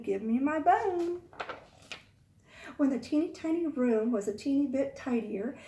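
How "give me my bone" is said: mild spooky voice. Retold or imitated. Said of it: "a voice coming from somewhere in my classroom saying".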